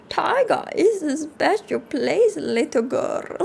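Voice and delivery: put-on slowly, as a Russian granny to a child; Russian grandma. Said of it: "my best Russian grandma".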